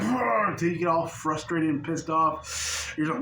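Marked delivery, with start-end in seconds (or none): 0.0-0.5 a short, higher, louder growled roar of frustration; a playful fed-up roar; mock roar. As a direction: roar like you've had enough. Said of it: "Until you're like".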